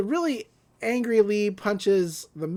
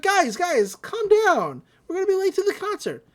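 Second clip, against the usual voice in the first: put-on kid voice; 11 semitones higher than usual. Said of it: "Dipper's like".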